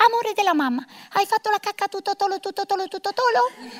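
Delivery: put-on soft lisping mommy voice for a toddler; baby talk. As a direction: coo at a small child, lisping tutto tolo. Mother baby-talks to toddler son.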